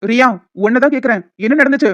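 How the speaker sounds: loud, rising to a near shout. Acted. Boss raises his voice to make Riya answer. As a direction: demand the answer now.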